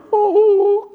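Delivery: very high whining oh; Stan Laurel whimper. Retold or imitated. Preacher imitates Stan Laurel whining to Hardy, very high. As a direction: squeak it out like a weepy Laurel. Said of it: "how Stan Laurel used to talk".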